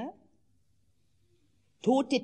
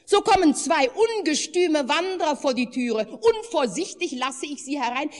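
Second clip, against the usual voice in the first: put-on high, louder, upset old woman's voice; agitated wife; 6 semitones higher, 5 dB louder than usual. A narrator's reading. The wife tells her husband about the will-o'-wisps, agitated. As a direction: pour out the complaint breathlessly.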